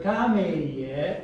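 "kame" drawn out with stress on KA; first-syllable stress. Shown as a demonstration. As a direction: stretch "kame" slowly, leaning on its first syllable. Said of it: "Listen, where's the stress?".

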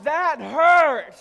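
loud pained complaint. Retold or imitated. Husband complaining to wife after the pluck, loud aggrieved whine. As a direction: make the hurt heard.

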